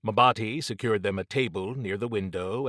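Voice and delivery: ordinary delivery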